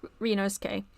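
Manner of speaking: ordinary talk, nothing marked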